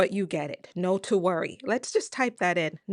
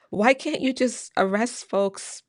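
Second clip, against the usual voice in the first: ordinary talk, nothing marked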